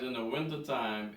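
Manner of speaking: ordinary talk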